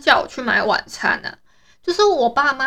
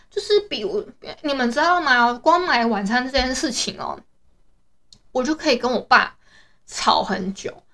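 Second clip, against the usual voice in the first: normal talking voice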